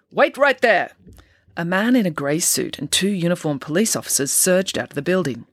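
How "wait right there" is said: loud, higher-pitched order to halt; commanding call. A narrator's reading. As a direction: call out sharply to stop someone. Officer calls out to stop them.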